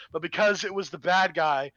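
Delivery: usual speaking voice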